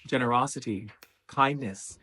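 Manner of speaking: normal talking voice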